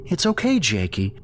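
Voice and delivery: hiding her fear behind sweetness; soothing mother. A narrator's reading. Mother kneeling to comfort her crying son, soothingly. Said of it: "comfort Jake".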